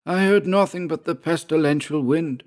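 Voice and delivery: high, strained character voice; hoarse Georg. A narrator's reading. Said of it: "said Georg hoarsely".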